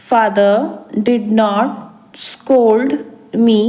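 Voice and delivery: Father, did not, scold me said very slowly in separate pieces; slow chunked repeat. Shown as a demonstration. Teacher to learners, very slow chunked repetition. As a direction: drill it word by word, very slowly. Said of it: "teacher repeats in slow chunks".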